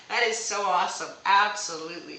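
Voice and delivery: loud, excited praise; loud, happy agreement; delighted. Real, not acted. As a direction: cheer the viewer on. Reacts to viewer's reply.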